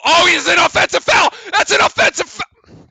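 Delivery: very high, loud, laughing call; excited laughing shout. Real, not acted. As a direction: crow it out with glee. Delighted call of a foul.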